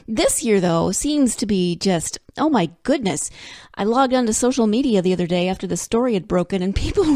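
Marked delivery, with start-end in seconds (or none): none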